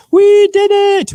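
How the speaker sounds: very high, very loud drawn-out cheer; triumphant cheer. Real, not acted. Streamer finishes camera changeover, triumphant shout. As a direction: stretch it out in loud celebration.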